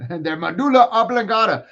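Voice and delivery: loud, silly movie-quote voice; movie character impression. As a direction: blurt it out like a goofy movie character. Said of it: "I actually watched that movie".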